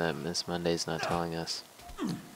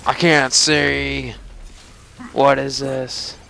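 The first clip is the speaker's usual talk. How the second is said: startled cry; 7 semitones higher, 6 dB louder, slower than usual. Real, not acted. Player blinded during boss fight.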